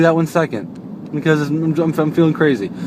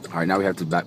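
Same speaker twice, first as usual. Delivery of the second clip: ordinary talk, nothing marked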